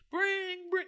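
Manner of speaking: high-pitched drawn-out ringtone; imitated phone ring. Phone rings at the big climax, high imitated ring. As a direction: mimic the phone going off.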